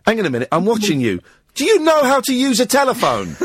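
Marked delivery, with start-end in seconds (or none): 1.5-3.4 exasperated, teasing question; mock exasperation. Real, not acted. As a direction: tease him like a scolding teacher. Host mocking caller's phone use.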